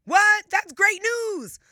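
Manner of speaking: high, loud, slow burst of delight; a loud, high gasp of happy surprise; surprised delight. Acted. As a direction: act thrilled by the news. Host mock surprise at good news.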